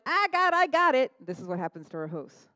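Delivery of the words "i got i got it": mock cocky. Quoting prideful self-reliance.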